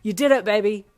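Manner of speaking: loud, proud cheer; excited yell. A narrator's reading. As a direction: shout congratulations to your partner. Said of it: "he yelled with excitement".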